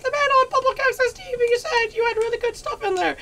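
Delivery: put-on high-pitched kid tattling; mock tattling kid. Host voices kids raiding mom's cabinet, mock tattling squeal.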